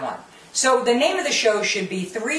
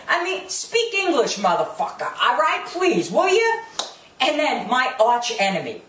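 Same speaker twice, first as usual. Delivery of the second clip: usual speaking voice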